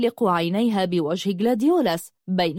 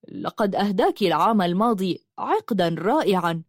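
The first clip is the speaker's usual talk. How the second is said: plain reading voice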